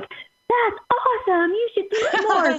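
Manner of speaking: put-on high, slow, gushing teen girl voice; excited teen friend. Daughter's friend loving the British accent, high excited gush. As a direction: gush over it like an impressed teenager. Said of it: "And her friend is like".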